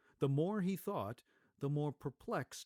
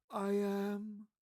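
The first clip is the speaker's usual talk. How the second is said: ghostly spirit; 8 semitones higher, 3 dB louder than usual. Acted. Ghost of Christmas Past.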